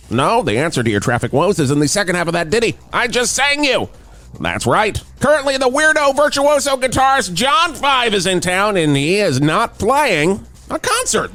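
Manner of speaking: brisk, animated radio announcer read; radio traffic report. Acted. Traffic reporter tells Valverde listeners about [name], in an animated announcer voice. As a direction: sell the traffic news like a zany DJ.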